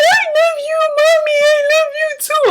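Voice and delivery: put-on very high, tearful little-girl voice; crying child. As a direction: imitate a small daughter crying to mommy. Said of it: "and she crying like".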